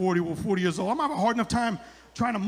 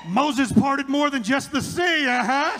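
loud, slow, higher-pitched boast; sassy old woman; 5 semitones higher, 7 dB louder, much slower than usual. Retold or imitated. Old woman bragging to the comic, sassy imitation. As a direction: stretch it out as a brazen old lady. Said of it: "must have been a hundred and ninety-four".

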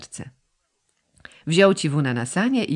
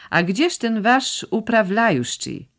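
Russian-tinged drawl; 7 semitones higher, 3 dB louder, slower than usual. Acted. Russified brother's casual greeting.

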